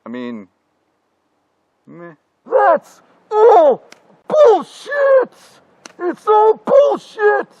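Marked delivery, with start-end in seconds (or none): 2.4-7.5 much higher, louder, furious shout; angry outburst. Acted. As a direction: explode with disgust. Future self outburst.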